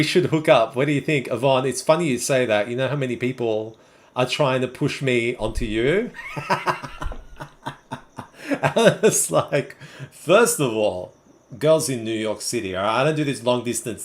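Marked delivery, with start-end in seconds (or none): 6.2-8.0 high, trailing laughter; real laugh. Real, not acted. Streamer amused at being pushed onto a viewer, real laughter. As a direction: burst out laughing, then let it run down.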